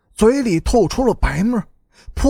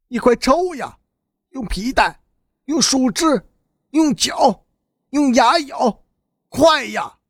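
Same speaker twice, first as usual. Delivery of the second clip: higher-pitched, insistent goading in short bursts; urgent urging; 5 semitones higher than usual. Acted. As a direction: egg him on, pressing harder each phrase. Victim urging the other to beat him. (急切催促)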